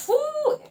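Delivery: high, delighted "whoo"; excited whoop. Real, not acted. Tarot reader thrilled by a lovely card, excited whoop. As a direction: let out a high-pitched whoop.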